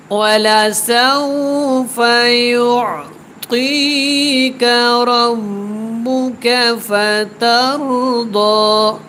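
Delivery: slow, melodic tilawat, each word drawn out; measured, sung-out Quranic recitation. Shown as a demonstration. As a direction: chant the verse slowly and melodically. Recites full verse.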